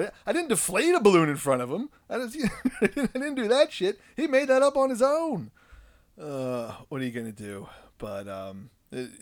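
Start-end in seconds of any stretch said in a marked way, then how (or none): 0.0-5.5 high, fast, louder protest; mock-indignant dad. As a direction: plead innocence with a laugh. Protesting innocence comically.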